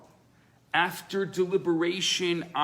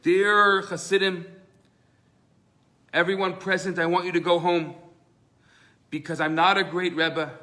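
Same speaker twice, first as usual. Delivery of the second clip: public announcement; 3 semitones higher, 4 dB louder than usual. Retold or imitated. Rebbe loudly announcing to his followers he is simple. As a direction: declare it to the whole synagogue.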